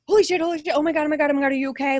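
imitating a driver freaking out after hitting someone; high panicked babble; panicking. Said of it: "Like, panicking".

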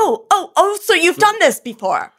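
high, loud shocked realization; sudden outraged surprise. Acted.

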